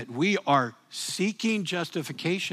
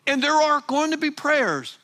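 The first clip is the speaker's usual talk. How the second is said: full-force preaching; 8 semitones higher, 3 dB louder than usual. Acted. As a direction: lean into every word. Preaching climax to congregation.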